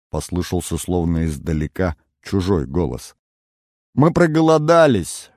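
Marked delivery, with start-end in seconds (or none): 3.9-5.3 plaintive. A narrator's reading. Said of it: "голос Кицуны звучал жалобно".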